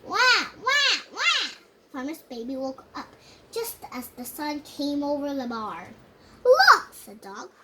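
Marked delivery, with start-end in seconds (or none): 0.0-1.5 loud, much higher wah wah wah; a child doing a loud baby cry. A narrator's reading. Farmer's baby wakes up. 6.4-6.9 very loud, much higher look; dog calling out as the sun comes up; excited call. A narrator's reading. Dog sees the sun rise.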